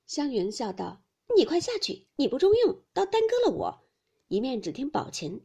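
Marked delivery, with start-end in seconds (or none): 1.4-3.8 high-pitched, louder teasing rebuke; playful scolding. A narrator's reading. Xiangyun shooing a rival out of the linking-verse contest, playful scolding. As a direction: shoo them off in mock impatience.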